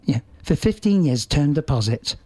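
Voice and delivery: soft, slow hypnotic ad read; soft banking ad voice. Retold or imitated. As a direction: soothe like a bank commercial. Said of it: "It's a bit soft".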